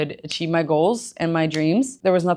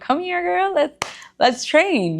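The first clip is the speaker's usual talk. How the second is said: much higher, slow, eager call; excited invite; 12 semitones higher, slower than usual. Retold or imitated. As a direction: hype up a new client, pitched high. Inviting clients to train.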